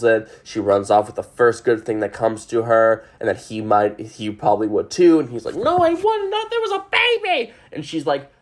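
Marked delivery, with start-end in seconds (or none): 5.5-7.5 high, pleading voice for Cliff, well above her own. Retold or imitated. Retelling Cliff's denial to Sally, high and pleading. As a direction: protest that you would never leave.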